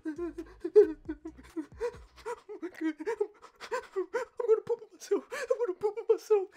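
high, strained mock whimper; mock frantic panic. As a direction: act out a squealing, half-laughing panic. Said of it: "I'm on my mat like this".

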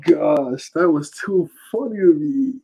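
normal talking voice

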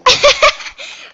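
high burst of surprised laughter. Real, not acted. Laughing at the vote result.

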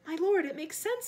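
relieved underling addressing his master. A narrator's reading. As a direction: agree with relief. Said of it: "relieved now".

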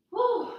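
high, loud relieved whew; playful tired exhale after holding a pose; mock whew. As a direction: blow out like it was hard work.

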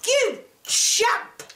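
high-pitched scolding yell. A narrator's reading. Mother to Jack as she flings away the bean, yelling. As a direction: yell it as a high, exasperated insult. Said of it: "She yelled".

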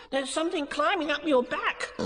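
high, squeaky warning; excited little animal squeal; urgent cry. Acted. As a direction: cry it out in alarm. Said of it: "he cried".

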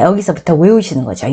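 normal talking voice

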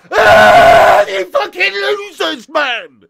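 loud, drawn-out shout of contempt; angry yell. Real, not acted. Fan yelling abuse at his losing team, furious. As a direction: bellow it at the players.

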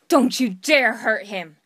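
loud, fierce protective threat; fierce warning. Acted. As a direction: warn them off with force. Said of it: "that sounded, I don't know".

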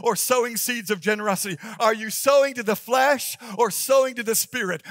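loud, fervent preacher voice; preaching. Acted. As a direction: preach it with full force. Preaching at full force.

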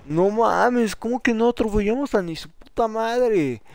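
loud, high outraged protest; indignant disbelief. Real, not acted. Failed to run enemies over.

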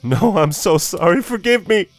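high, loud, desperate scream; anguished shout climbing very high; screamed plea. A narrator's reading. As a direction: scream for forgiveness as if waking from a nightmare. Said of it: "screamed Jonah, shouting himself awake".

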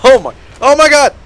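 high, loud startled cry; shocked shout. Real, not acted. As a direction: caught off guard, yell it. Sudden ambush in game.

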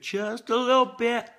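high, playful squeak on it; squeaky high-pitched repeat. Shown as a demonstration. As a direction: pitch it way up, teasing.

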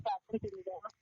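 whispering on the phone. Real, not acted. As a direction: keep the voice low on the phone. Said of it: "এত আস্তে কথা বলো কেন".

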